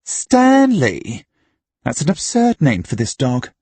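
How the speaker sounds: high, incredulous outburst at a silly name; scornful disbelief. A narrator's reading. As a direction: exclaim it high, then dismiss it. Trueblood scorns the dog's name.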